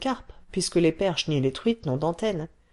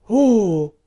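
much louder swelled oh; big booming oh; 3 semitones higher, 10 dB louder than usual. Acted. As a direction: puff up the voice on oh. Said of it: "en gonflant sa voix".

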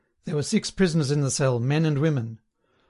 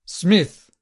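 loud, higher-pitched summons; yell; 4 semitones higher, 6 dB louder than usual. A narrator's reading. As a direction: call the prisoner sharply to order. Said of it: "yelled the voice from the telescreen".

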